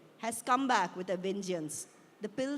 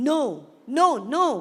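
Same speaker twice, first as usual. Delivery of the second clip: loud, higher refusal, each no separate; emphatic refusal; 4 semitones higher, 12 dB louder, much faster than usual. A narrator's reading. Daughter refusing the pills from her father, loud and emphatic. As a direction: refuse loudly, rising in pitch.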